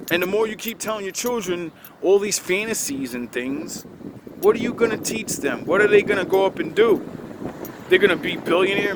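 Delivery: usual speaking voice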